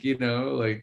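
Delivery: normal talking voice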